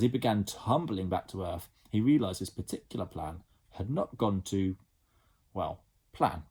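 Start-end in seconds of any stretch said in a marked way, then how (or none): none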